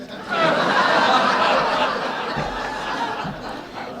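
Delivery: high burst of real laughter; big laugh. Real, not acted. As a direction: laugh out loud at the irony. Laughing at the therapist's verdict.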